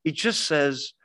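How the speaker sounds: usual speaking voice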